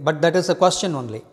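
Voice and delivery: ordinary talk, nothing marked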